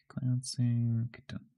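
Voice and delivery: soft unvoiced whisper. Shown as a demonstration. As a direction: breathe the words with no voice.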